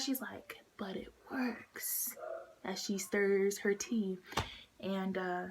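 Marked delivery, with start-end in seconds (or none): 0.8-2.1 slow, whispered insistence; hushed mom. Retold or imitated. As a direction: whisper it slowly like the hypnotist mom. Retold hypnotist mom urging him.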